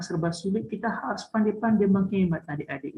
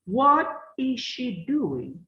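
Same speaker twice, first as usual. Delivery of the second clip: slow model reading; 5 semitones higher, 3 dB louder, much slower than usual. Shown as a demonstration. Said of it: "teacher models the question slowly".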